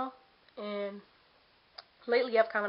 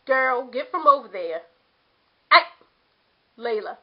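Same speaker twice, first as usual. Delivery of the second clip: sudden loud, higher call to a pet; real scolding call; 3 semitones higher, 10 dB louder than usual. Real, not acted. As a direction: call the cat away sharply. Calling the cat away mid-video.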